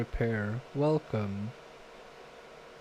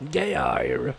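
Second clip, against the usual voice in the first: Geir with a rolled, pirate-ish ending, like a sailor's growl; a touch of pirate on Geir. Shown as a demonstration. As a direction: say Geir like a pirate would, gey-ah-r. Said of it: "This kinda sounds like a pirate".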